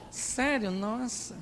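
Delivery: soft, understated mock interest; lightly acted sarcasm, trailing away quietly; ironic husband. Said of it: "cuidado com ironia".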